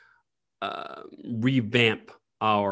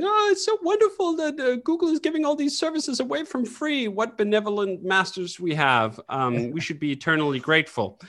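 much higher, mock-adoring praise; sarcastic gushing; 9 semitones higher than usual. Mocking the public cheering on Google with sarcastic gushing. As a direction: gush with heavy sarcasm. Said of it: "cheering them on and going".

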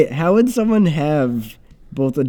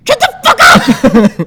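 high, very loud, drawn-out scream; hammed-up furious yell; enraged villain shriek; 11 semitones higher, 10 dB louder, slower than usual. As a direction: explode at the spoiled riddle in a high villain voice. Riddler erupts when riddle is answered.

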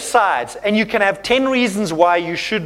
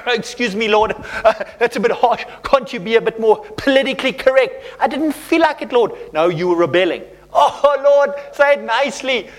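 higher-pitched, half-laughing pleading; mock protest to God; 3 semitones higher than usual. Preacher acting out us answering the Lord, high comic protest. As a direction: play a put-out believer haggling with God. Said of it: "I'm like".

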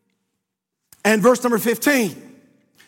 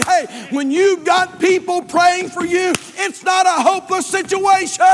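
preaching climax; 6 semitones higher than usual. Real, not acted. Sermon climax, music.

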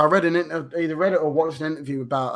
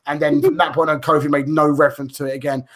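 ordinary talk, nothing marked

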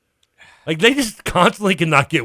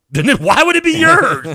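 high, louder, fed-up comeback; exasperated retort; 6 dB louder than usual. Retold or imitated. Arguing with the older lady over her food, exasperated. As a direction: throw it back at her, fed up.

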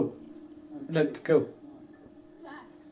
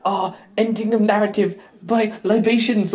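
higher, slowing mock German accent; Werner Herzog impression; 7 semitones higher than usual. Telling his co-host a favourite line, German-accented impression. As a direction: quote it grandly in a German filmmaker's accent. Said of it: "in the most Werner Herzog way ever".

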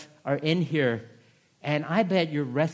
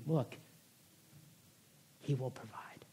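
hushed, breathy reassurance as God; God's whisper; 4 semitones lower, 12 dB quieter than usual. Acted. Preacher voicing God to the worried, whispered. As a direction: whisper the promise gently.

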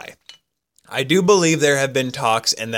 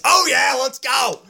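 excited cheer; 12 semitones higher, slower than usual. Real, not acted. Cheering his own pun.

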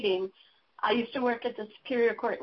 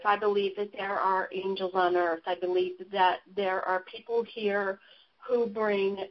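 normal talking voice

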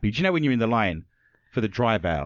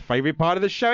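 ordinary delivery

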